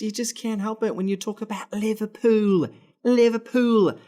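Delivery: high, loud hammed-up British lilt; fake British accent. Fan explaining why Liverpool makes him go British. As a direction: put on a theatrical British accent. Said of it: "go in and out of my fake British accent".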